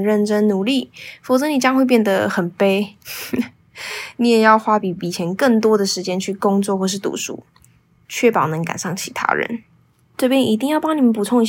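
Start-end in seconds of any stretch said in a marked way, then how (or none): none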